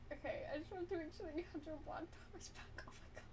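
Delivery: quick hushed whisper aside; fast whisper. Real, not acted. Streamer whispering a quick aside to chat. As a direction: rush it out under your breath.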